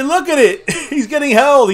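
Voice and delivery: laughing in disbelief. Real, not acted. Laughing while arguing about holding.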